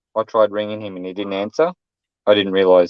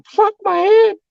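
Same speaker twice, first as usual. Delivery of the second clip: much higher squealed mock pain; mock pained cry; 4 dB louder, slower than usual. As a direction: yelp it like your skull just hit a helmet. Imagined friend after headbutting helmet.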